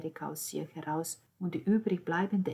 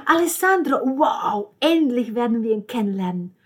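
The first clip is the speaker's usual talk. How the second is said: much higher, louder, thrilled voice; excited squeal; 7 semitones higher, 13 dB louder than usual. A narrator's reading. Nanina eagerly rushes to meet Alessandro, thrilled squeal. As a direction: burst out with eager delight. Excited sister rushing upstairs.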